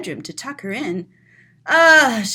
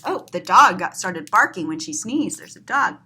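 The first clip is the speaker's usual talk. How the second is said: plain reading voice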